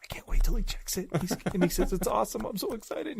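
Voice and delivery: high, whispery excited squeal; giddy anticipation. Retold or imitated. Retelling his wait for the client's verdict, giddy high half-whisper. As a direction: act out a kid's breathless wait on Christmas morning. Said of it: "it was almost like Christmas morning".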